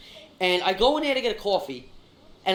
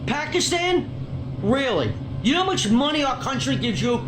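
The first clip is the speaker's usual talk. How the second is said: raised, heated disbelief; real indignant tirade; contemptuous anger; 3 semitones higher than usual. Real, not acted. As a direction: throw it back as an angry challenge. Berates clerk over his country.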